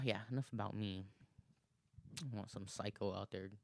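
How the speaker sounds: ordinary talk, nothing marked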